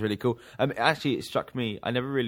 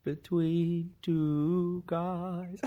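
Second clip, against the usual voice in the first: sing-song; 8 semitones higher, much slower than usual. Acted. Jokey drawn out tagline.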